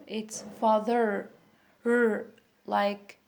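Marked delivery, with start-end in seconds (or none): 0.6-2.3 father, then the bare R held alone; American R in father, repeated by itself; curled-tongue R. Shown as a demonstration. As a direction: curl the tongue on the end of father and isolate the R. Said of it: "like this sound".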